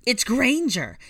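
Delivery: high, loud battle command; shrieked order to the troops. A narrator's reading. Bellatrix sets Death Eaters on Hermione, ordering loudly. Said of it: "Bellatrix ordered".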